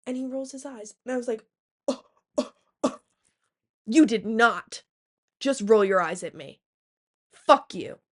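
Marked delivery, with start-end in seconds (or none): 1.9-8.0 loud, slowing indignant yell; retold outburst. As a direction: shout him down, offended. Eye roll sparks retold fury.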